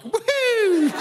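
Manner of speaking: high, loud "woo-hoo" cheer; celebratory whoop. Retold or imitated. English crowd celebrating Guy every year, high whooping cheer. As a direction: let out a piercing party whoop. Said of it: "So every year we're like".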